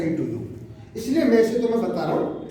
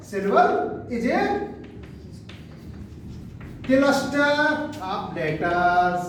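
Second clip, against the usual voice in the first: slow dictation; 3 dB quieter, much slower than usual. Shown as a demonstration. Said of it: "teacher repeats definition slowly".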